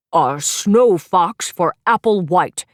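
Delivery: measured, booming bear professor; Professor Papa Bear. Acted. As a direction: present each pet slowly like a kindly teacher bear. Professor Papa Bear announces pets.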